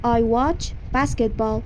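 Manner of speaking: slow teacher demo of the sentence; slow model sentence. Shown as a demonstration. Teacher modelling English for Persian students, slow. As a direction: say it slowly for repetition. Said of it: "من بسکتبال".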